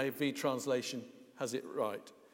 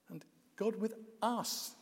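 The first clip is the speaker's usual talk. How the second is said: "us" hit at the end, voice higher; stress on US; 8 semitones higher, 4 dB quieter than usual. Shown as a demonstration. Preacher showing the congregation which word to stress, stress on us. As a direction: lift and land on us. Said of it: "if you stress the word".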